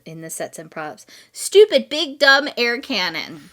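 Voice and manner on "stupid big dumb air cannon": loud, high, disgusted stupid, then slow; annoyed reading. Retold or imitated. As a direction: read it out with scorn. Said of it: "read the other version".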